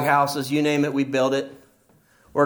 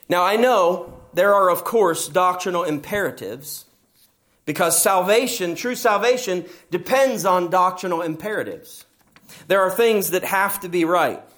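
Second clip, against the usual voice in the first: usual speaking voice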